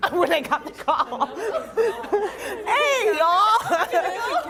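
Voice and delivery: high, loud laughing call. Real, not acted. Laughing, calling friends again.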